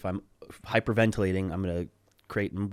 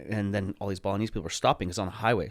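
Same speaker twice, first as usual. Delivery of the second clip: plain reading voice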